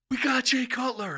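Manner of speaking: high, thrilled mock cry; retold excitement. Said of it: "I got so excited".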